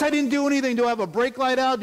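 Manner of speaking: panicked. Retold or imitated. Panicked driver seeing cop lights.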